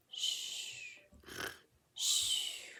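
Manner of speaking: breathy snore with a hushing exhale; sleepy snore-and-whoosh; imitated snoring.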